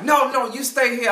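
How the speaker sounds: high, loud insistent order; angry salesman. Retold or imitated. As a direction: keep her there, hammed-up anger. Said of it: "he would get angry".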